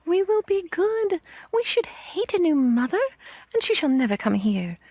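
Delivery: very high pleading, easing down by the end; piping, desperate promises to mother; children crying out. A narrator's reading. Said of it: "they cried".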